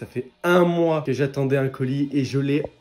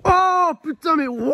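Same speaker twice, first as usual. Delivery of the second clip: shocked shout; 7 dB louder, slower than usual. Real, not acted. Stunned reaction to game goal.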